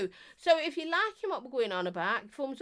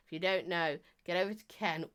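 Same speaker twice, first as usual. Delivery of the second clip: ordinary talk, nothing marked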